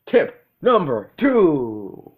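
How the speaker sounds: slow, high, loud announcer voice; spooky announcer. Acted. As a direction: drag out the tip title dramatically. Tip title in a spooky voice.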